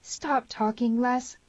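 high, urgent command. A narrator's reading. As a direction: order him to be silent. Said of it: "commanded the girl".

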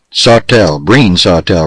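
usual speaking voice

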